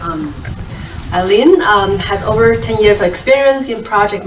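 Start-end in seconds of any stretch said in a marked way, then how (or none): none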